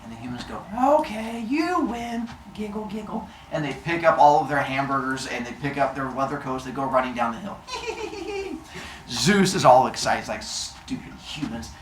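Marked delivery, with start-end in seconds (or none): 1.0-3.3 soft singsong fake defeat; feigned surrender. Humans fake surrender in story. 7.6-9.0 high, soft giggling; tiny high-pitched titter; gleeful giggle. Acted. Humans giggle running off. 10.4-11.7 slow, hushed gloat; soft, drawn-out contempt; secret gloating. Acted. Zeus gloats to himself.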